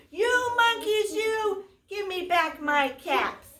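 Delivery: hammed-up storybook shout, not full force; shouting peddler. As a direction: shout up the tree at the monkeys. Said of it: "he shouted".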